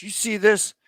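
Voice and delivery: high-pitched, mock-indignant retort; cocky put-down. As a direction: pull rank in a high voice. Aircraft commander snaps at his navigator.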